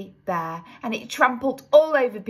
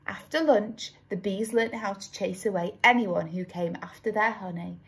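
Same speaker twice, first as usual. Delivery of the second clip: usual speaking voice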